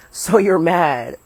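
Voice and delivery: slow, loud teasing; drawn-out taunt. Real, not acted. Teasing the one who wants to go inside, slow taunt. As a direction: stretch it out to needle someone.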